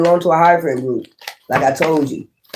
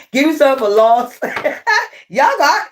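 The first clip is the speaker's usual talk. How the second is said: laughing; 7 semitones higher than usual. Real, not acted. Laughing at own slurp.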